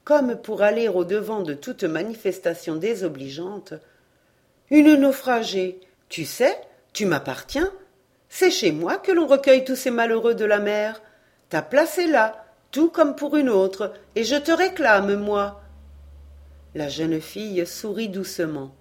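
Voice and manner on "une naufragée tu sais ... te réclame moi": higher and louder, firmly sheltering; an old widow shielding the castaway, firm and higher; protective claim. A narrator's reading. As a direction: claim her before anyone objects. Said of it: "d'un geste de protection".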